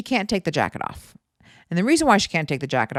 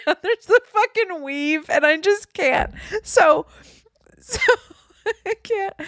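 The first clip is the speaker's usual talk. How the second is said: words squeezed high by giggles; laughing hard; 14 semitones higher, slower than usual. Real, not acted. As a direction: give up helplessly mid-laugh. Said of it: "it just makes me laugh so hard".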